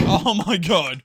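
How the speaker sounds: real startled yell; startled outcry. Real, not acted. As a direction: cry out in sudden alarm.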